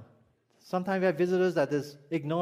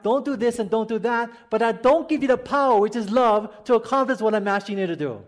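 louder, higher preaching push; fiery preaching; 4 semitones higher, 6 dB louder than usual. Acted. Pastor pressing the congregation about love, full-force preaching. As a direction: drive the point home from the pulpit.

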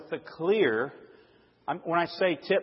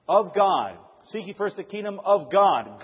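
"Of God" punched louder as the closing phrase; stress on Of God; 3 semitones higher, 4 dB louder than usual. Shown as a demonstration. Preacher showing whose kingdom it is, stressing "of God". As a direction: land firmly on "Of God". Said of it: "I emphasize every single word".

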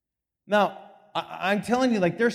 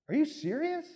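amazed disbelief; 10 semitones higher, 3 dB quieter than usual. Retold or imitated. Husband amazed at the news.

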